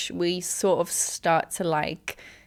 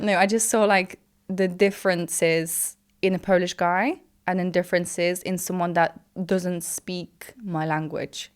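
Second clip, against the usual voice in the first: ordinary talk, nothing marked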